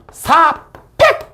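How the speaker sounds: 사 drawn out high, then 팩 popped; slow high 사 and an abrupt 팩; punchy short reading. Shown as a demonstration. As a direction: stretch 사, then burst out 팩. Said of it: "이렇게 읽을게".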